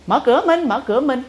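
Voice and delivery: shouting to be let in; calling out. Retold or imitated. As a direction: call through the door from the rain. Friend calling at door in rain.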